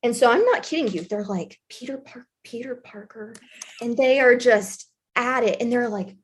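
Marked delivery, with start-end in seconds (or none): 1.7-3.4 much softer, under the breath; hushed, halting repeat of a name; quiet puzzling. Retold or imitated. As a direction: imitate working it out to yourself. Office ladies puzzle over name.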